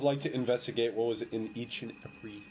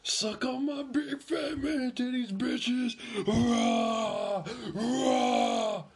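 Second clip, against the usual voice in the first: huge fiery dragon roar; 12 semitones higher, much slower than usual. Acted. Hammed-up roaring bravado.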